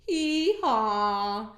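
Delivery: loud hee-haw; the donkey brays. Acted. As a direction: bray it like a donkey. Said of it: "And the donkey said".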